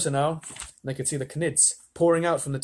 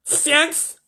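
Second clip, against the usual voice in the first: high, loud demand; yelling president; 14 semitones higher, 7 dB louder than usual. A narrator's reading. The president yells at the astronauts over radio, high and loud. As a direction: bark at the astronauts. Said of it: "cried the president".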